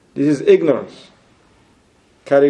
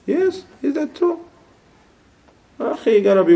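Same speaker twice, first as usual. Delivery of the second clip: sarcastic high question; 12 semitones higher than usual. Acted.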